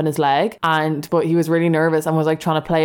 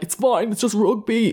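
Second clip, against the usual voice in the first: mock tough rugby lad; 5 semitones higher than usual. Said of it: "he's just like".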